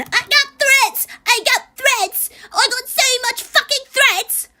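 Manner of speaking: high, slow mocking whine of a victim. Streamer mocking creator's threat complaints in a high whine. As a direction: sneer it out in a high whiny voice. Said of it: "Can we stop with the fucking".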